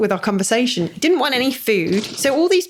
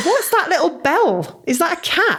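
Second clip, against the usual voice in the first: surprised; 8 semitones higher than usual. Real, not acted. Spots cat on camera.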